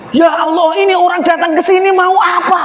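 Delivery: high, shocked exclamation; startled cry. Acted. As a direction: gasp it out in alarm. Abid startled on seeing her.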